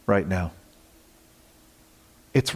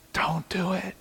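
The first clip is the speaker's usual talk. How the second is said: whisper; 5 semitones higher, 9 dB quieter than usual. Acted. Pleading warning to straying believers.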